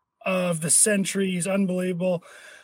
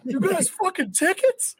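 high, incredulous squeal of excitement; excited disbelief; 6 semitones higher than usual. Retold or imitated. Retelling his reaction to Jude's tickets, high excited disbelief. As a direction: pitch way up in giddy disbelief.

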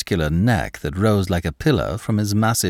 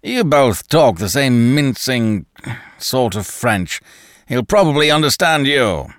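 mocking Danton; 3 semitones higher, 3 dB louder than usual. A narrator's reading. Danton mocks both men's French, loud. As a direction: sneer at their French.

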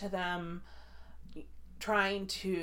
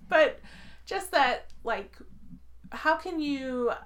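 trying not to laugh; 7 semitones higher, 4 dB louder, slower than usual. Real, not acted. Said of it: "I'm trying to be serious here".

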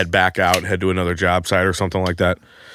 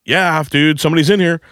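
annoyed calling out; 6 semitones higher than usual. Retold or imitated. Calling through door after knock.

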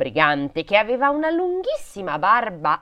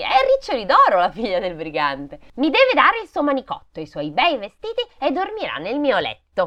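put-on loud, raised child's voice; bossy little girl; 6 semitones higher, 4 dB louder than usual. A narrator's reading. Brigand's daughter claiming Gerda's muff and clothes, bossy. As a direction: lay claim like a spoilt child.

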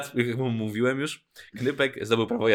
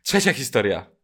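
weary but loud announcement; tired voice; 2 semitones lower, 5 dB louder than usual. Real, not acted. Host moving on to the third story, tired. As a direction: announce it as if worn out. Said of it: "powiedziałeś już takim zmęczonym głosem".

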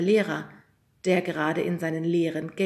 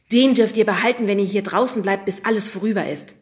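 firm bargain; 3 semitones higher, 8 dB louder than usual. A narrator's reading. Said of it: "sagte der Lehrer".

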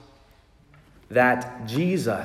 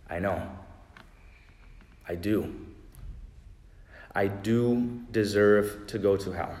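soft, lowered, resigned confession; bow the head and admit it softly; a quiet, contrite "I know, I do"; humble admission; 3 semitones lower, 7 dB quieter than usual. Retold or imitated. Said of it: "He simply bows his head".

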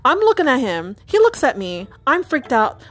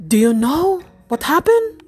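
put-on raised, Filipino-accented dad's question; 7 semitones higher, 4 dB louder than usual. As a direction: ask it higher, with a light Filipino accent. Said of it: "my impression of Filipino accent".

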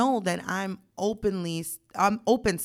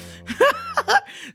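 high-pitched burst of real laughter; cracking up laughing; 5 dB louder than usual. Real, not acted. Podcaster laughing at his texts, cracking up. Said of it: "I'm cracking up in real life".

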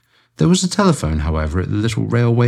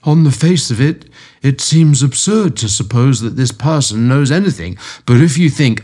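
higher, lightly louder objecting; Amberley; 6 semitones higher, 4 dB louder than usual. A narrator's reading. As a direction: protest on, half-convinced. Client keeps objecting.

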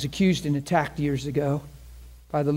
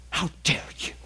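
angry quote; 5 dB quieter than usual. Retold or imitated. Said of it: "she was just way mad".

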